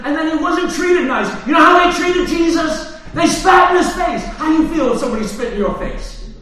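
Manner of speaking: loud, high, near-shouting sermon; preaching at full force. Acted. Preacher telling the church how Jesus was mocked, shouting and fervent. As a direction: cry it out to stir the congregation.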